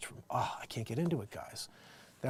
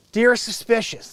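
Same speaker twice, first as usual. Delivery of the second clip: loud, raised read-out voice; letter read aloud; 10 semitones higher, 14 dB louder, slower than usual. Acted. Host reads a Dear Abby headline, projected. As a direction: announce the letter to the audience. Reads advice column reply.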